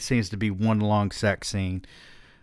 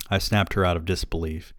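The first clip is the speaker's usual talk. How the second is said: ordinary delivery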